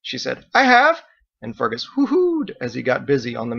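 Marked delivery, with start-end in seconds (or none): 0.5-1.0 high, loud reply down the hall; loud call back. A narrator's reading. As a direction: shout it back. Margot calls back.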